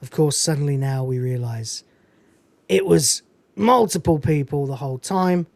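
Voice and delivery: ordinary talk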